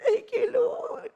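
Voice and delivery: whispered, slow thanks; weeping whisper; tearful whisper. Retold or imitated. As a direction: breathe it out through tears. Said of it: "when I'm crying".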